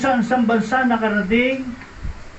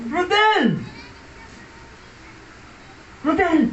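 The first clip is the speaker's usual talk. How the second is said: high repeated call of a name; calling out again; 6 semitones higher, slower than usual. Retold or imitated. Ragged old friend calling Rodel, high and insistent.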